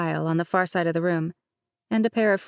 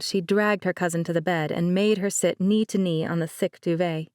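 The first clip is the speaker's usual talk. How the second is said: usual speaking voice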